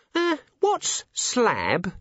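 put-on high, hesitant young dwarf; nervous high voice. A narrator's reading. Cheery asks.

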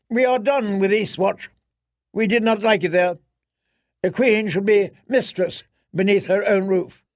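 high, imperious royal voice; haughty queen voice. Acted. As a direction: look down on everyone as queen.

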